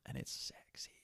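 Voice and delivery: breathy whispered sexy. Real, not acted. Telling listeners candlelight is sexy, whispered. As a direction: whisper it like a secret.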